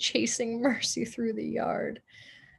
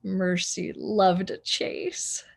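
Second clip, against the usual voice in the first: ordinary delivery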